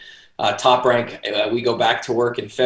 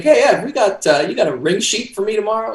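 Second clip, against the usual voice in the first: high mock voice; 10 semitones higher, 4 dB louder than usual. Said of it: "I'll be like".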